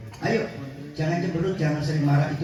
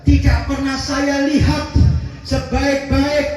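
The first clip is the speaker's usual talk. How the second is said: fervent preaching; 12 semitones higher than usual. Real, not acted. Emphatic translation of hadith.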